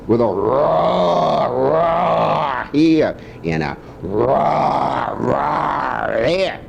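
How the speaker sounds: stretched, slow playful roars. Shown as a demonstration. Grandpa singing roars for his grandson. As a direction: roar it out slowly. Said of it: "Old MacDonald had a lion".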